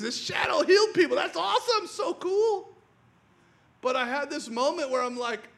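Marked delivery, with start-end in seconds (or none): 0.0-2.7 fast, high, half-laughing delight; excited gushing. Retold or imitated. Preacher recalling his delight at a favourite verse, gushing. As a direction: gush over the verse like a fan.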